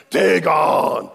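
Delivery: loud mock presentation; booming announcement. Preacher showing off huge Dagon, booming. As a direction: boom the idol's name. Dramatizing the giant statue.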